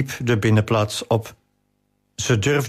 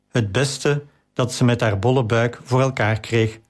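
plain reading voice

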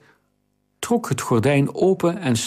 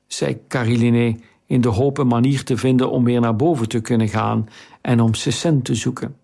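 ordinary delivery

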